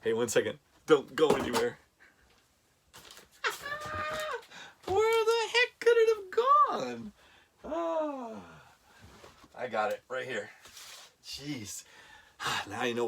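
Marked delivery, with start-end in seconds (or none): 3.4-4.8 very high nervous giggle; nervous laugh. Real, not acted. Nervous laugh while searching. 4.9-8.3 much higher, strained frantic voice; frantic searching. Real, not acted. Searching frantically for his notes.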